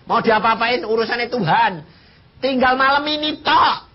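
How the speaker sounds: high, loud preaching climax; fervent preaching. Real, not acted. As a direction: drive it home to the congregation.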